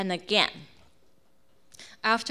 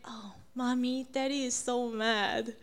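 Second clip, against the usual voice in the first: hushed, slightly raised little worried voice, imitating kids; slow, timid mock whimper of a complaint; scared children; 2 semitones higher, 4 dB quieter than usual. Kids tell Mommy that Daddy is mad, scared.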